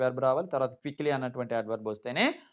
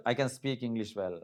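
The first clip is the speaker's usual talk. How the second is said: slower, softer correction; quieter correct version; 4 semitones lower, 7 dB quieter, slower than usual. Shown as a demonstration. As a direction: say the right version softly. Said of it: "ఆ చిన్న తేడా అంతే". (సరైన వాక్యం మెల్లగా)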